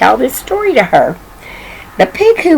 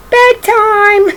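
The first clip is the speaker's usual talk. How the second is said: much higher, sung-out call; singsong bedtime call; 12 semitones higher than usual. Acted. Daddy calling the baby to bed, high singsong.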